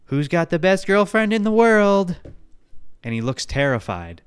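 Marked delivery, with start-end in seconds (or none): 0.0-2.1 put-on high, sing-song girlfriend question; high cooing girlfriend voice; sweet girlfriend voice. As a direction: coo it like a doting girlfriend. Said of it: "and she's like".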